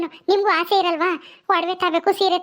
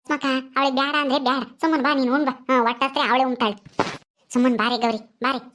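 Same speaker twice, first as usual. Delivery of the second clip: put-on soothing older mother; 6 semitones lower, faster than usual. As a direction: hush her gently like a village mother. Mother figure calls to eat.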